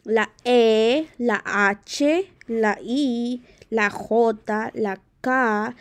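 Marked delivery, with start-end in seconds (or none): none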